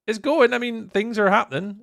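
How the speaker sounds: high, loud upbeat chatter; bubbly and upbeat; excited. Real, not acted. Excited streamer banter.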